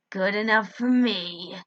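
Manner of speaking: slow, menacing hiss; a creature gloating over its prey. Acted. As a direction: hiss it like a monster. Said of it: "it hissed".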